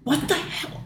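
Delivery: high, hushed mock outburst; mock mad. Retelling the officer whose gun fails, mock mad and high. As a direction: imitate fury in a thin, high squeal. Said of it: "he's so mad".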